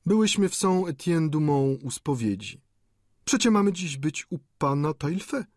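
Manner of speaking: high-pitched woman's voice; lady's voice. A narrator's reading. Lady answering the landlady about church, high voice.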